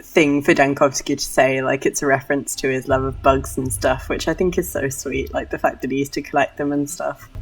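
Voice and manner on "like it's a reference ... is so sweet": ordinary delivery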